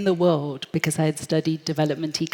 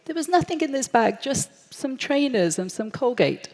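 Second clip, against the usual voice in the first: high, fast, panicky protest; rushed nervous squeak of innocence; nervous denial; 8 semitones higher than usual. Retold or imitated. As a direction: blurt it out, rattled and defensive. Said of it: "And I start sweating".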